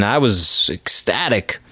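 ordinary talk, nothing marked

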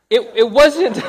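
loud, high, laughing as the words come out. Real, not acted. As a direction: break up laughing mid-sentence. Breaks up over towel confession.

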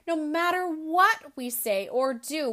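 plain reading voice

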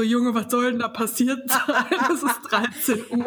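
quoting with a laugh; laughing quote. Retold or imitated. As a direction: laugh through the retort. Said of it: "Und du so".

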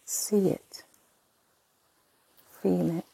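hushed whisper. Acted. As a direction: drop to a bare whisper. Whispered cue in meditation.

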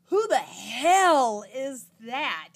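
loud, slow, furious demand; angry rival bursting through the door; ragey. Retold or imitated. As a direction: storm out and bark it at the girls. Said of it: "that is just ragey".

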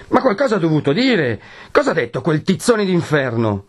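put-on young man demanding answers from the friar; high heated outburst; angry demand. A narrator's reading. Renzo's angry outburst continues.

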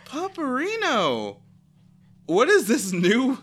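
very high, loud laughing shrieks; shrieking disbelief. Real, not acted. Owner shrieks at her dog humping the guest. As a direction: squeal at the dog in shocked delight.

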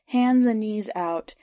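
usual speaking voice

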